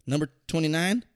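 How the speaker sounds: high, loud disbelieving questions; incredulous uncle. Retold or imitated. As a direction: imitate the farmer uncle baffled by her pick. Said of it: "He's like".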